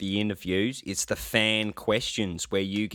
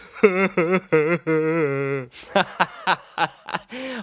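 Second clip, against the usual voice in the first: loud high burst of laughter; real happy laughter; delighted laugh; 7 semitones higher, 4 dB louder than usual. Real, not acted. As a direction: laugh with glee at the bit. Delighted after the impression.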